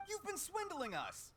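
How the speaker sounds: high, indignant cry of betrayal; outraged accusation. Acted. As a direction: accuse him, stunned and hurt. Merchant accuses the official.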